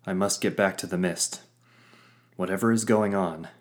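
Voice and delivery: ordinary delivery, nothing marked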